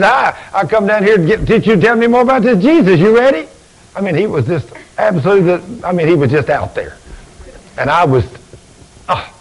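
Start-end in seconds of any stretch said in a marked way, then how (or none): 0.0-3.5 put-on high, keen young visitor; eager kid. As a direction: bounce in ready to hear more. Eager young man at the door.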